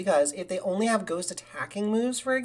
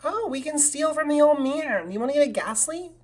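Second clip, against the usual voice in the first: excited; 9 semitones higher, 4 dB louder than usual. Real, not acted. Excited discovery in game.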